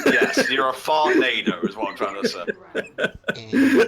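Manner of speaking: laughing at own pun. Real, not acted. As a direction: push the pun out through a laugh.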